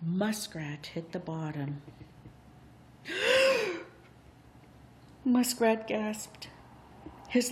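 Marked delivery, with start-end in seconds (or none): 3.1-3.8 high strangled gasp. Acted. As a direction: choke on a gasp. Muskrat gasps on the bottom.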